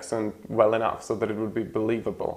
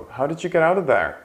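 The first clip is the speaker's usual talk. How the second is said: fast high model; 6 semitones higher, 4 dB louder, faster than usual. Shown as a demonstration.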